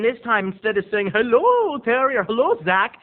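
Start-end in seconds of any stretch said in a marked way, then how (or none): 1.1-2.2 high, loud, sing-out hello; over-the-top happy hello; excited greeting. Retold or imitated. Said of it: "usually she's so excited to see me". 2.2-3.0 higher, louder hello; mock-delighted hello; excited greeting. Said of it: "usually she's so excited to see me".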